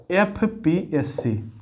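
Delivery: drawn-out letters; slow dictation. Shown as a demonstration. Teacher introducing the old acronym, slowly. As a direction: spell it out slowly for notes. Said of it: "UPSC का परिणाम था एफ़पीएससी".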